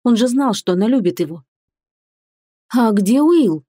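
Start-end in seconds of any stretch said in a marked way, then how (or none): 2.7-3.6 a touch of worry in the question; lightly anxious, still controlled; uneasy question. A narrator's reading. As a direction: ask with mild concern. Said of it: "спросила девушка с беспокойством".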